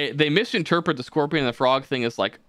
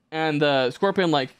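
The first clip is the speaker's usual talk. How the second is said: normal talking voice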